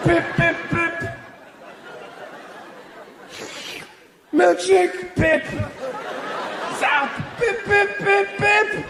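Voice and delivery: high, chirpy bip noises; video game bleeps. Retold or imitated. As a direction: mimic a games console beeping.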